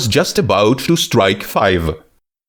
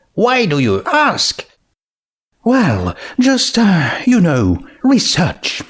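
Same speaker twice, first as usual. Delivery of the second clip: character voices; 8 semitones higher than usual. Acted.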